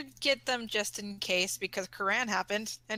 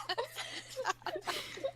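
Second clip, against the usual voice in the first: high breathy giggle; 8 dB quieter than usual. Real, not acted. Cast cracking up.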